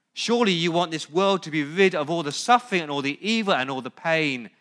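loud, high, pressing appeal; impassioned preaching. Acted. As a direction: plead with them at full force. Preaching at full force.

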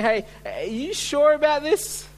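high, rising, incredulous query; doubtful questioning. Acted. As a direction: question it with pitch leaping up. Voicing doubtful Gideon questioning God.

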